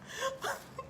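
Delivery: high airy wheezing laugh; high breathless laugh. Real, not acted. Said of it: "I'm laughing because".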